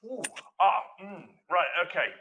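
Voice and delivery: high, loud wary "ooh, ah" of a man caught off guard; hesitant boss stalling for time; taken aback. Retold or imitated. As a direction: sound doubtful and startled at the idea. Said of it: "he looked at me and went".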